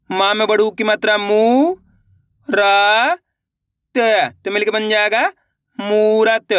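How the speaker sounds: मू रा त said as separate drawn-out syllables, then blended; slow phonics demonstration for learners; syllable-by-syllable demo. Shown as a demonstration. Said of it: "देखिए किस तरीके से".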